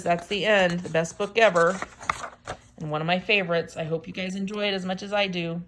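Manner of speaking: ordinary delivery, nothing marked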